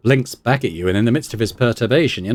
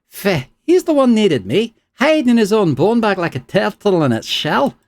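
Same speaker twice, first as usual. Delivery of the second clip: scornful spirit; 10 semitones higher, 3 dB louder than usual. Acted. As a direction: scoff at the weakling host. Isilmir voice.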